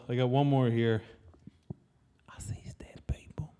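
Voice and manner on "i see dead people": breathy whispered line. Acted. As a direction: whisper it like a secret. Famous quote, whispered.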